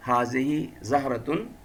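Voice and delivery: حاضی زہرتن slow, with the final تن clearly sounded; careful Arabic reading. Shown as a demonstration. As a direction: spell out زہرتن slowly with its ending. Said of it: "ہم اس کو اعراب کے ساتھ پڑھیں گے". (آہستہ، اعراب کے ساتھ تلفظ)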